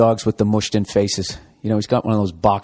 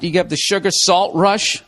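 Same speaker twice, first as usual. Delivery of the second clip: ordinary talk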